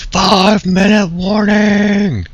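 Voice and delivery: booming, slow, high arena announcer; Quake 3 announcer voice. Pretend. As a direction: call it out like a game announcer. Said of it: "Quake three announcer voice".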